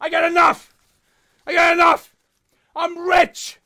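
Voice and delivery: high, strained hammed-up protest; mock exasperated shouting. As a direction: holler it in mock despair. Mock protest at being given money.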